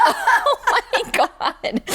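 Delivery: slow, high laughing gasp. Real, not acted. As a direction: say it through a real laugh, stretched out. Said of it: "I was like".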